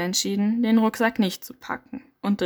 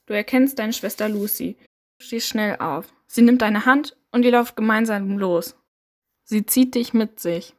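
steady second-person storytelling voice; story narration. A narrator's reading. Narrator guiding the listener through the dark forest, steady storytelling.

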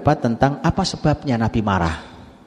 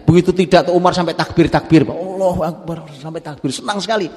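much higher, elated retelling; joyful telling; 7 semitones higher, 3 dB louder than usual. A narrator's reading. Preacher sharing Umar's relief and joy, elated and high.

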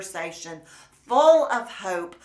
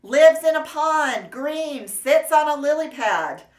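put-on higher and louder, urging the kids; preacher prodding silent children for an answer, higher and louder; pleading preacher; 6 semitones higher, 5 dB louder than usual. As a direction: push hard for any answer from the room. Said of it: "He looked around".

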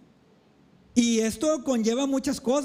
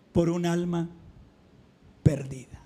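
hushed whisper; 5 semitones lower than usual. Real, not acted. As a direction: voice drops low, "perdida" whispered. Preacher drops to hushed climax. (susurro grave al final)

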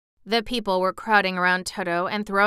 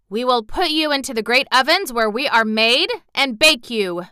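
threatening; 4 semitones higher, 6 dB louder than usual. Acted. Mister Bun threatens Dorothy.

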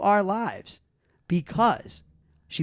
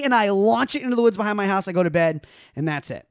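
ordinary talk

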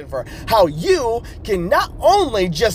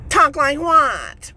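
slow mock retort; 6 semitones higher, much slower than usual. Coach retorts slowly.

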